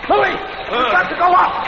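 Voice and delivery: high, loud urgent call; fleeing rats upward; urgent shout. Acted. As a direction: yell him upstairs.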